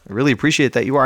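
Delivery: normal talking voice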